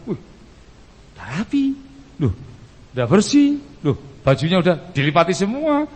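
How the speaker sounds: high, rising mock surprise; acted delighted astonishment; amazed wife. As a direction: marvel at each thing as if just noticing it. Wife discovers the tidied house.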